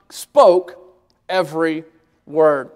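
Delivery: "spoke", "every", "word", each set apart by a pause; deliberate full stops between "spoke", "every" and "word"; word-by-word emphasis. Real, not acted. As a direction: land "spoke" hard, then pause before "every" and "word". Drives point home word by word.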